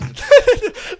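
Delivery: sudden high burst of laughter; real laugh bursting out; loud burst of laughter. Real, not acted. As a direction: crack up out loud. Friend laughing at a joke.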